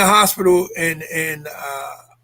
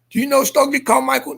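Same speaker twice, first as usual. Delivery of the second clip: urgent retold questioning; 7 semitones higher than usual. Questioning father.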